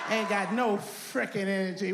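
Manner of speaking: ordinary delivery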